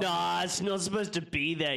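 mock scolding. Acts out correcting the menu, laughing.

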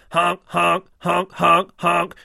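loud honks like a goose; goose honking. Acted. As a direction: honk like a farmyard goose. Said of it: "was all that was heard".

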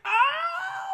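very high drawn-out mock wail; hammed-up breakdown cry, high and loud; emotional mock wail. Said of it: "got really emotional and".

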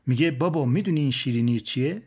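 ordinary delivery, nothing marked

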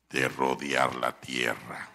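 very low, slow demon voice; demon's reply. Acted. Possessed man answers where he comes from, deep demonic voice. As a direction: drop deep and draw it out like a demon.

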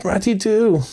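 high, pleading Mole calling after Ratty; Mole crying out. A narrator's reading. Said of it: "cried the Mole".

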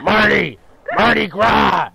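high, loud barfly drawl; Red at the Two Bar. Retold or imitated. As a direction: belt out Mardi Gras like a bar regular. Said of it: "my Red at the Two Bar impression".

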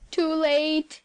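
loud despairing cry; tragic exclamation. A narrator's reading. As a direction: cry out that all is lost. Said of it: "he exclaimed in a tragic tone".

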